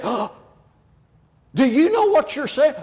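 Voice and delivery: gasp, then a high, loud question; acting shocked. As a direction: act scandalized and call them out. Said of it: "just act shocked and say".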